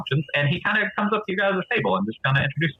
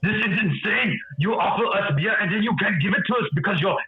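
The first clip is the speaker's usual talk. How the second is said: higher, heated complaint; angry Goliath; 5 semitones higher than usual. Acted. Goliath ranting at the bartender, angrier. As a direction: rant about the missing drink. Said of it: "he's getting more angry".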